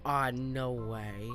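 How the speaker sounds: ordinary talk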